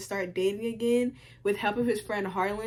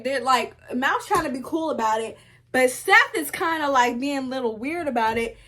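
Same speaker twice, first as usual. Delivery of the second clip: ordinary talk, nothing marked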